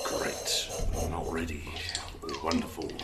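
soft, deflated sarcasm; quiet resigned sarcasm; dejected. Acted. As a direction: mutter it, crushed.

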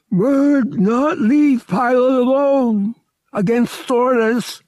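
high, loud, slow alien growl-voice in broken speech; a huge feline alien insisting on duty; big alien cat. Acted. Alien guard Murg tells Han he must stay, in a high, loud, slow alien voice. Togorian Murg refuses to leave.

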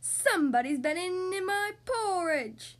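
loud, slow, drawn-out bear growl; big outraged bear voice; Daddy Bear growl. A narrator's reading. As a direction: growl it slowly like an angry papa bear. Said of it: "he growled".

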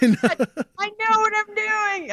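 high, cocky mock bravado; fake confidence. Herself pretending to be an expert, with mock bravado. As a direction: bluff like a seasoned pro.

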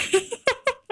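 high giggle of disbelief; giddy high laugh. Real, not acted. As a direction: laugh out the excitement. Laughs after announcing the move.

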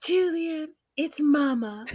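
high mock-plaintive mother; Debbie impression. Acting out a mother phoning her son, high plaintive voice. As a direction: announce yourself high and needy. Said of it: "she's like".

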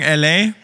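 L A blended into one word; verschliffenes L A. Retold or imitated. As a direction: say L A run together like "alley". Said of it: "die machen das A L E Y". (L A zusammengezogen wie „A-L-E-Y“)

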